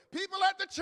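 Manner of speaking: loud, high church cry; preached shout. Real, not acted. Preacher calling out the church, shouting. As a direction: call it out to the congregation. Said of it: "can I say it again?".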